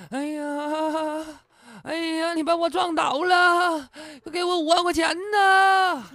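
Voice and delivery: high, drawn-out mock wail; hammed-up moaning. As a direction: wail theatrically like a fake injury victim. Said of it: "一边倒一边哼哼". (夸张地哼哼哭嚎)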